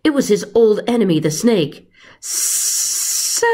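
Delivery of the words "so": breathless, voiceless hiss. Acted. Giant snake greeting his old enemy, hiss. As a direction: let it slip out as a hiss. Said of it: "he hissed".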